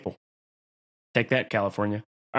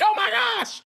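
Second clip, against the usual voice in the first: very high mock shout of alarm; mock panicked shriek; slower than usual. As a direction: shriek like a terrified newcomer. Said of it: "They're like".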